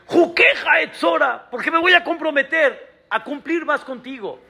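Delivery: high, shouted promise to God; shouted plea. Retold or imitated. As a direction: cry out the vow with force. Pleading answer to God.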